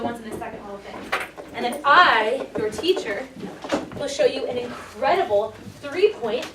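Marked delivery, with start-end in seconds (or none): 1.8-6.5 slow, emphatic showman's announcement; mock-grand hype. Teacher playfully hypes their slideshow to the class, mock-grand. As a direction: announce it grandly, punching key words.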